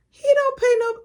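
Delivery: high, loud, furious mom; high, loud mock mom yell; mom's angry yell. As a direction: yell down the phone like an angry mother. Mom yelling on the phone.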